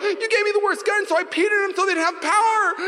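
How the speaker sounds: put-on very high, crying kid whine; whiny kid excuse. Boy excusing himself to mom, high crying whine. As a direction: whine it like a caught child. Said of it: "I was like".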